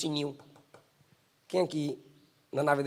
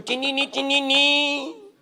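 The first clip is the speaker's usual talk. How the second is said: bell ringing; 9 semitones higher, 4 dB louder, much slower than usual. Retold or imitated. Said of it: "o pastor".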